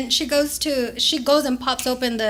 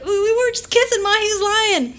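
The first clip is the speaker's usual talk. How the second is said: put-on high pleading teen voice; teen excuse; 8 semitones higher than usual. Teen daughter pleading with her mom, high whine. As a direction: whine it like a caught teenager. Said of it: "I'm like".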